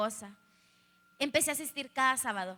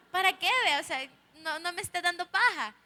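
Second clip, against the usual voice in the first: louder, higher imitation of impatient dad; exasperated retort; 5 semitones higher, 5 dB louder than usual. Father doubting his daughter's thanks, exasperated retort. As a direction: brush it off, raised and impatient.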